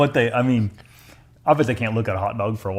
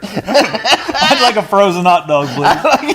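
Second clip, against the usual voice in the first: put-on high, slow, laughing kid voice; mock kid ordering; 9 semitones higher, 5 dB louder, much slower than usual. Kid politely asks for a frozen hot dog, giggling. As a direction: ask sweetly through giggles.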